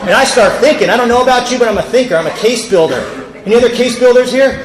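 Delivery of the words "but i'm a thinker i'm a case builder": ordinary talk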